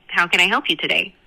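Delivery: ordinary talk, nothing marked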